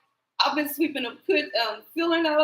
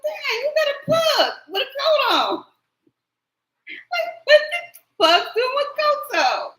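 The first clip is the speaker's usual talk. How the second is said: high, louder, disbelieving; retelling shock at a pug in a coat and wig; incredulous; 13 semitones higher, 4 dB louder than usual. Retold or imitated. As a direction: act stunned at the dressed-up pug.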